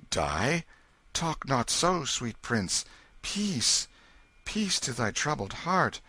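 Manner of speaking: put-on king calming the boy; reassuring king. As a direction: hush his fear gently.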